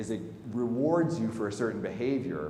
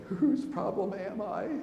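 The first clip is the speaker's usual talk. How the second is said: much higher, slow, strained crying question; tearful plea; 13 semitones higher, slower than usual. Retold or imitated. Begging the clinic woman on the phone, tearful and pleading. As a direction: ask it through tears, pleading. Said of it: "So I was just crying".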